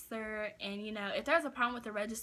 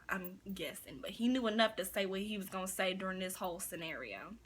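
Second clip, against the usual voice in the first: ordinary delivery, nothing marked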